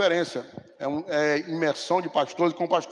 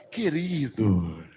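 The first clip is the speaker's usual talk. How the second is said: very slow, lower, gentle fatherly pastor; slow it right down and sound calmly sure; voicing the senior pastor kindly insisting; pastor Sandro; 7 semitones lower, 3 dB quieter, much slower than usual. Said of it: "Aí o pastor Sandro".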